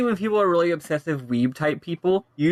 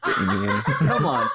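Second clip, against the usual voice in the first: ordinary delivery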